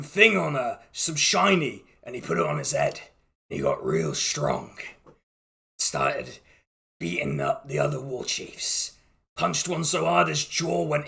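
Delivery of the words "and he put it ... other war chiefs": deep, hushed storyteller voice; ominous low narration. A narrator's reading. Game master telling the players about the chief, low and ominous.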